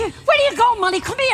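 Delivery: calling out. Acted. Calling after Molly, high.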